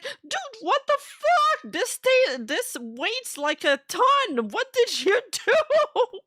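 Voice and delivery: high, slow shocked friend with the bag; high mock disbelief. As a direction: screech it in disbelief, dragging it out. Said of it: "he was like".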